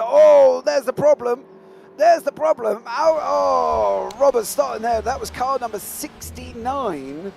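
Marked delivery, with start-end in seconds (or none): 0.0-5.0 loud, high, sudden alarm; excited shout. Real, not acted. Commentator spotting a car blocking a qualifying lap, excited shout. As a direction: yell it as the incident unfolds. Incident on track, commentator reacts.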